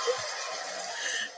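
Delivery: wheezing, breathless laughter; breathy laugh. Real, not acted. Woman cracking up at her own hair slip, breathy laughter. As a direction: burst into airy laughter.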